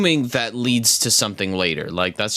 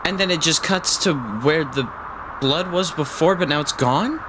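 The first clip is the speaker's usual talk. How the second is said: higher, puzzled disbelief; surprised; 8 semitones higher than usual. Real, not acted. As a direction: still rattled, asking in disbelief. Confused after the scare.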